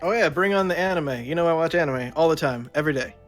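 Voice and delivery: usual speaking voice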